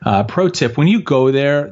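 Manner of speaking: ordinary talk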